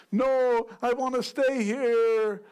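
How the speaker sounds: high, loud hammed-up begging; mock pleading Paul. Preacher mocks a Paul who begs not to die. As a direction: play Paul whining for his life. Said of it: "that was my accent".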